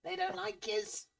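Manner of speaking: high, squeaky ratfolk whisper-talk; squeaky rat man. Acted. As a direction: play a twitchy little rat man, very high. Rat man keeps talking.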